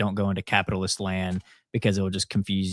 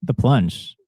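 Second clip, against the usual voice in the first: excited; 5 semitones higher, 8 dB louder than usual. Real, not acted. Building up to the segment.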